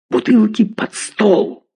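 high, drawn-out order to the room; commanding cry, pitched well up; sharp command. A narrator's reading. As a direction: call out an urgent command. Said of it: "скомандовал Чубаристов".

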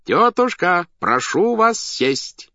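loud, high, drawn-out call. A narrator's reading. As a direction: shout it out like a showman to the ring. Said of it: "крикнул хозяин". (громкий выкрик)

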